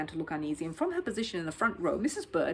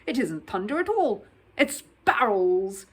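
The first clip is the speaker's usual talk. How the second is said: booming; 7 dB louder, slower than usual. Acted. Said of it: "boomed Sir Huntley".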